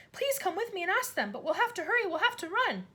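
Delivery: put-on high breathless girl; urgent child. A narrator's reading. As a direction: urge her to hurry. Matilda voiced.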